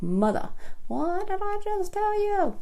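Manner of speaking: high mock-scolding daughter; daughter's scolding voice. Daughter scolding her mom over the wrong foundation. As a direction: play the exasperated daughter telling mom off. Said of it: "She'll be like".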